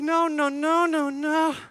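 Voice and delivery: loud, high string of refusals; high, hammed-up alarm; panicked protest. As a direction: frantically stop a friend confessing. Panicked reaction to friend confessing.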